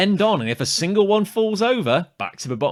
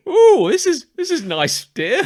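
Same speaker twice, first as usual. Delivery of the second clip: a lightly voiced cosy wife, rising ooh; mock relaxed spouse; 10 semitones higher, 7 dB louder than usual. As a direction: sound smugly content with your book. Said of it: "with a book going".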